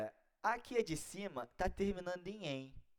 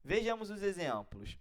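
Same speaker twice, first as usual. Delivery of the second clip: usual speaking voice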